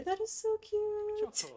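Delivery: high, slow squeal of delight; gushing excited. Real, not acted. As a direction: gush it out. Gushing over the boyfriend story.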